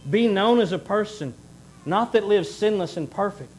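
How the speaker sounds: ordinary talk, nothing marked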